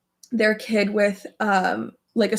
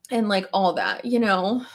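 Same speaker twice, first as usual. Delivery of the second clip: ordinary delivery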